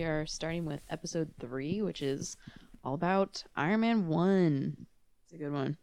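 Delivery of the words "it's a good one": dry, understated sarcasm; low-key fake endorsement; sarcastic. Real, not acted. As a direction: say it drily, not meaning it. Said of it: "I say that with sarcasm".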